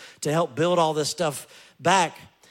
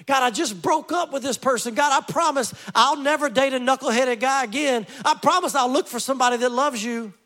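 higher, hammed-up desperate pleading; pleading prayer; 8 semitones higher than usual. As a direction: beg God like a panicked believer. Mimicking our anxious prayers.

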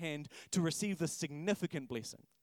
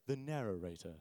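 lower, deep storyteller read of The narrator; narratory voice; 9 semitones lower, 6 dB quieter than usual. Shown as a demonstration. Pastor asks the congregation for a narrator voice, lower and softer. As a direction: intone The narrator like a film narrator. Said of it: "Can you say it in a narratory voice?".

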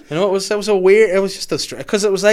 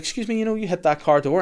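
pitched way up, polite but pointed; high polite call; 8 semitones higher than usual. Retold or imitated. Kath calling to the woman.

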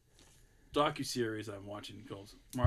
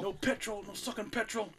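put-on mild Irish English accent; 7 semitones higher, 3 dB quieter than usual. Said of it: "Irish English accent".

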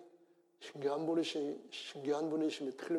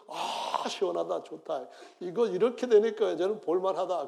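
lightly voiced Jonah, a touch of contentment; pleased Jonah; 4 semitones higher, 7 dB louder than usual. Jonah enjoying the plant's shade, lightly voiced. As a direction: savour the cool shade as Jonah, mildly.

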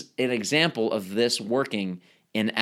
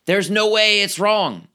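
emphatic certainty; 6 semitones higher, 4 dB louder than usual. Retold or imitated. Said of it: "Underlined, capitalized, cursive".

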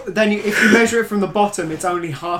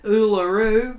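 Uluru in an Australian accent, pitched up with a rising end; broad-strokes Australian take on Uluru; 4 semitones higher, 3 dB louder, much slower than usual. Shown as a demonstration. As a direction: say Uluru like an Australian, higher, tune lifting. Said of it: "sort of say it in an Australian accent".

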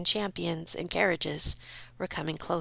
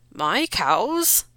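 disbelieving repeat; 2 semitones higher, 4 dB louder than usual. Acted. Farmer repeats in disbelief, louder. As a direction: repeat it stunned. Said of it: "managed to repeat".

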